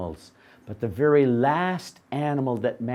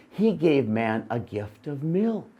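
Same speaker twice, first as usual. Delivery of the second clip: plain reading voice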